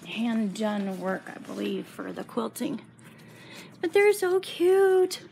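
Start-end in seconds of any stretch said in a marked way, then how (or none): 3.8-5.2 high, slow squeal of delight; gushing. Real, not acted. Quilter gushing to viewers over cute frogs, squealing. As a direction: melt over how cute they are.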